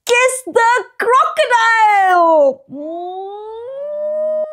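high, slow squealing kiss; excited big reveal. A narrator's reading. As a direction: build to a big smacky kiss. Climactic kiss with smack.

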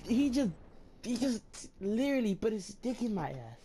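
high, slow, outraged complaint; indignant rant. Real, not acted. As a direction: drag it out in indignation. Outraged retelling of death.

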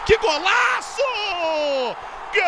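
high, loud cry of delight; excited shout. Real, not acted. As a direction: yell it like a stunning goal. Great goal shouted.

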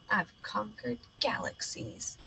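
hushed dramatic whisper. Acted. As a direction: breathe it like a secret boast. Dramatic aside, unvoiced.

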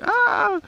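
high sharp yelp of pain; sudden yelp. Retold or imitated. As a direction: cry out as if stung. Stung by a bee, yelling.

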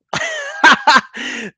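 sudden amused laugh; real laugh; high burst of laughter. Real, not acted. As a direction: crack up at the name. Laughs at viewer's name.

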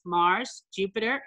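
usual speaking voice

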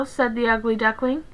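ordinary delivery

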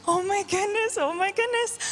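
high, urgent exclamations acting out a friend; excited retelling. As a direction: gush it high, bursting with news. Acts out neighbor bursting with news.

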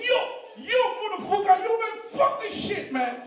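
high-pitched mock American girl; hammed-up high American voice. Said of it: "I know American girls, their ringtone is different".

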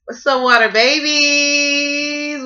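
loud, drawn-out high call to the viewers; big greeting. Real, not acted. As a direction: sing out the hello to your audience. Opening greeting to her viewers.

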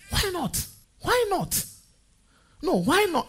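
high-pitched, insistent repeated question; indignant challenge. Acted. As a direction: throw it out high, demanding an answer. Challenging men who limit their wives.

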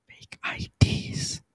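breathy, slow whisper. Pretend. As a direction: whisper it secretly. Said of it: "I don't know if they can hear you whisper that whispery".

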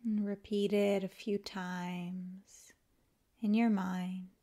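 calm, unhurried yoga nidra guidance with long pauses; guided meditation. Acted. Teacher guiding listeners to let the body melt, calm guided meditation. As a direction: ease them into rest, leaving space after each phrase.

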